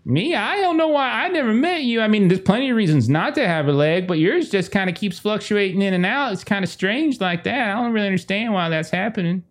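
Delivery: high, rambling folksy puzzlement; surprised, pitched well up, then easing; puzzled woman Tex. Acted. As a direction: play a baffled woman musing at a stranger. Tex talks about the leg.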